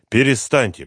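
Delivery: plain reading voice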